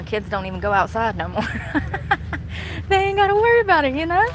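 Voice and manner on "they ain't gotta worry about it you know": laughing. Real, not acted. Laughing high remark.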